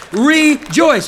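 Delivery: loud, high call; rejoice. Real, not acted. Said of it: "Everybody shout".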